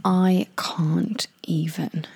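whispered, slow American "I can't even"; hushed mock American "I can't even"; American accent. Shown as a demonstration. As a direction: breathe it out slowly in a valley-girl American accent. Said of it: "sorry about my American accent".

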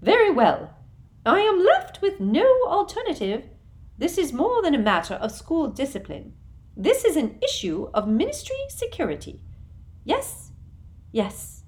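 high, prim and loud, talking herself into it; a simpering official steeling herself; Umbridge voice. A narrator's reading. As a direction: Umbridge convincing herself aloud.

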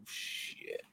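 hushed, low imitation of dawning dread; tiny drawn-out mock-scared voice; son's dread. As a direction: sink to a near-whisper, as the kid realising he's in trouble. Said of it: "he's like".

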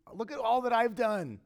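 high-pitched mock self-righteous complaint; hammed-up bragging about your own efforts; mock boasting. Preacher voicing convicted listeners to themselves, mock boasting.

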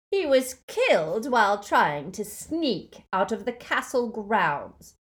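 loud, high, sneering dark lord; mock the defeated crowd with loud scorn; Voldemort gloating. A narrator's reading. Dark lord taunting the defenders, loud sneer.

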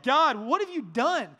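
anguished outcry, retold without real tears; weeping plea. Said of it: "like grown man weeping".

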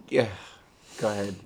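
ordinary talk, nothing marked

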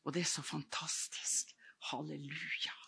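hushed, breathy praise; awed whisper. Real, not acted. Preacher whispering praise to God in awe.

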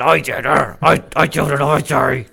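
aggressive dog voice through clenched teeth; loud, growly pissed-off dog; Augie. Acted. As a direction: force it out like an angry dog. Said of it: "he's kinda more aggressive".

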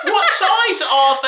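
put-on high, slow old woman voice; man doing a woman's voice; old lady voice. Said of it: "He did a voice like he's an old lady".

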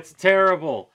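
dramatic. Acted. Dramatic complaining about the smell.

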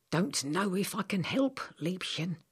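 a lightly voiced old man, doubtful; a hint of a worried old healer. Old man to Samantha, unsure he can help, lightly voiced. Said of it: "said the old man".

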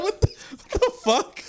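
very high laughing squeak; laughing squeal. Real, not acted. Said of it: "It's like a dying giraffe".

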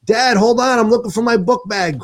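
put-on high, fast frantic yell at the kid; yelling at his son. Father yelling at his son to hurry, high frantic shout. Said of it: "I'm yelling at him".

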